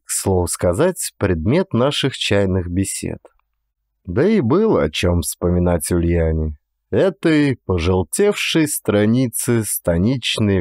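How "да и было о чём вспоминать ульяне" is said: ordinary delivery, nothing marked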